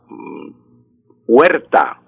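ordinary talk, nothing marked

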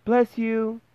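put-on very high little-boy squeak; tiny Tom calling from inside a pudding. A narrator's reading. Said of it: "called out at the top of his little voice".

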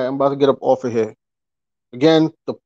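ordinary delivery

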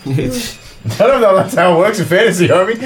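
high-pitched, laughing reply; amused objection. Real, not acted. Game master answering a player's escape plan, laughing. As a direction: laugh while doubting the idea.

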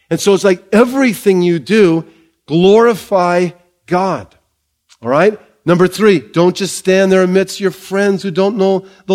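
rising, emphatic sermon delivery; full-force pulpit preaching. Acted. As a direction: preach it out to the church with conviction. Preaching to the congregation.

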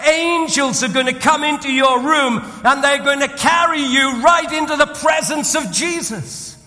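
high, loud, driving sermon voice; full-force preaching. Acted. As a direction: preach it out to the whole hall. Preaching at the deathbed story's peak.

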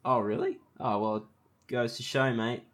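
ordinary delivery

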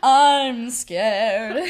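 loud, drawn-out mock fright; belted stage-coward voice, laughing after; scared lion. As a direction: play a hammed-up cowardly lion. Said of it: "And he's like".

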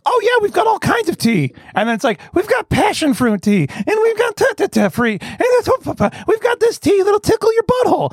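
very high, hammed-up sales patter; mock an overexcited host reeling off options; squeaky, stumbling mock enthusiasm; gushing host. Mocking host listing fancy teas.

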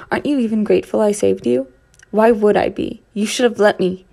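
loud, bitter exchange; heated. Acted. Archie and narrator arguing after the rescue, loud and bitter. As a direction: throw it back at each other.

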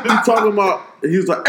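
ordinary talk, nothing marked

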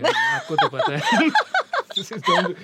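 high, loud laughing. Real, not acted. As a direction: squeal through laughter. Laughing at thanks.

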